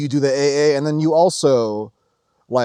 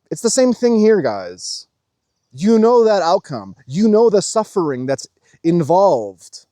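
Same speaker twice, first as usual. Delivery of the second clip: raised, louder preaching to the viewers; preachy; 5 semitones higher, 5 dB louder than usual. Acted. Telling his viewers the outcome, preaching louder. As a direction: hammer home the lesson, slowly.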